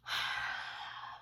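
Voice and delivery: long whooshing breath like howling wind; blowing a windy howl while dancing; breathy wind sound. A narrator's reading.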